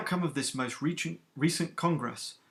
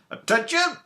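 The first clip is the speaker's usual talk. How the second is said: sharp shouted Attention!, much higher and louder; stern snapped command; 9 dB louder than usual. Acted. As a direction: bark it to silence the chattering recruits. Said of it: "snaps Captain Soma in her stern Celestian accent".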